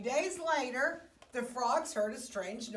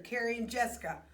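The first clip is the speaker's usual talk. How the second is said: usual speaking voice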